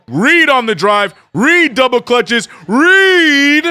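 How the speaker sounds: loud, high sportscaster call with stretched names; big broadcast hype, punching each name; excited play-by-play. Real, not acted. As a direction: call the basket live, building to a drawn-out shout.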